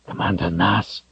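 a hint of a wary soldier's low caution. A narrator's reading. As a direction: warn him quietly. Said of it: "cautioned in a low voice".

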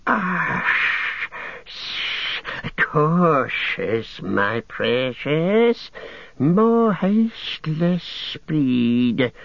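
slow, high hissing creature crooning to itself; creaking, whistling Gollum voice. A narrator's reading. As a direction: creep along the cliff muttering to your precious. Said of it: "his voice creaking and whistling".

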